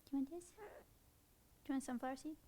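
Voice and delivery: quiet soothing pet talk; coaxing. Real, not acted. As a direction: win the bird back gently. Soothes the angry bird.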